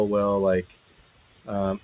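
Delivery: usual speaking voice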